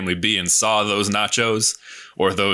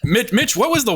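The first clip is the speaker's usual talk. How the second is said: eager call; 14 semitones higher, 3 dB louder than usual. Real, not acted. Calling out to a friend.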